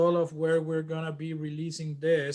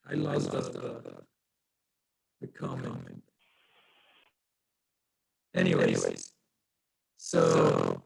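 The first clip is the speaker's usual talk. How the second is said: choppy, pitched-down glitchy line; auto-tuned broken audio; slower than usual. Real, not acted. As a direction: voice breaking up like bad video call. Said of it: "You sound heavily auto-tuned and broken up".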